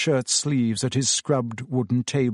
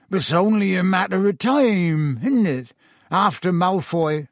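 higher, tipsy lament in a rustic voice; miserable; 5 semitones higher than usual. A narrator's reading. Drunk Hagrid expects the sack after Malfoy's injury, miserable. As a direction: wail it mildly with self-pity. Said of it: "said Hagrid miserably".

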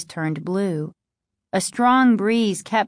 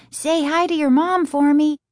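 high-pitched parting yell; hurried goodbye call, pitched up; 8 semitones higher, 3 dB louder than usual. A narrator's reading. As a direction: call it out as you rush off.

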